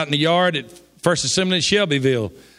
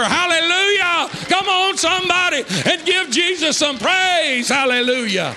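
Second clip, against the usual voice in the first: full-force preaching; 10 semitones higher than usual. Real, not acted. Preacher whooping hallelujahs to his congregation, full-force praise. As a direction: lift the whole room with every hallelujah.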